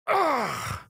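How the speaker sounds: sharp high grunt of pain, struck across the chest; hurt grunt as the claw lands. Acted. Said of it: "Zio hissed in pain".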